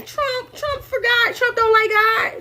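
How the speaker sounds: high, whiny mock of upset Christians; mocking churchgoers. Mocking upset Christians about Trump, high whiny voice. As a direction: pitch it way up like fussy believers tattling. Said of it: "you got all these Christians saying".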